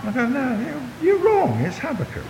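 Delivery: a hammed-up protest, high and put-on; mock indignant American. A hammed-up indignant American in a sermon joke.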